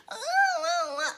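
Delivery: very high, wordless whimper; exaggerated petulant moaning; mock whining. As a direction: imitate a whining eighth grader. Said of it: "like an eighth grader whining".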